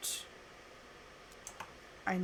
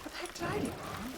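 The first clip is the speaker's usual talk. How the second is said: confused friend voice; 9 semitones higher, 6 dB quieter than usual. Retold or imitated. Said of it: "Kyle's like".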